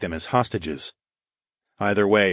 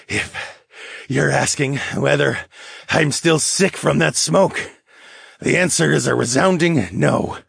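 grudging lynx man; 7 semitones higher than usual. Acted. Lynx demi-human to his rescuer, grudging and higher-pitched. Lynx character voiced beside narration.

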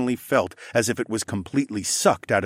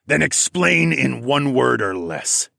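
slow, raised challenge; stern challenge; 4 semitones higher, 4 dB louder, slower than usual. A narrator's reading. Wolfman daring the accused to explain, slow challenge. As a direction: throw down the challenge slowly. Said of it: "the wolf man challenged".

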